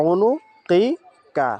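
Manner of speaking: উনুকিকা stretched very slowly, syllable by syllable; slow drawn-out recitation. Shown as a demonstration. Teacher modelling উনুকিকা for students, very slow and stretched. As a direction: hold every sound of উনুকিকা long. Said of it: "উচ্চারণটা কি".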